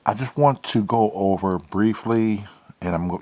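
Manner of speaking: usual speaking voice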